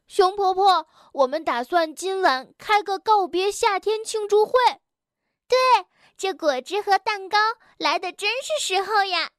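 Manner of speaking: put-on high, eager childlike voice; excited little animal children. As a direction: announce the party plan as a thrilled kid. Children share party plan. (高而兴奋的童声)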